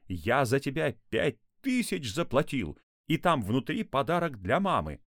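high, agitated yelling; shouted protest. A narrator's reading. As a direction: yell in outrage at a runaway. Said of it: "закричал папа".

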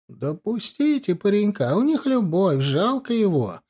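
put-on drawn-out, high-pitched elderly grumble; grumbling elderly nurse. A narrator's reading. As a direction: plead for him like an elderly nurse. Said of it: "пробурчала пожилая медсестра".

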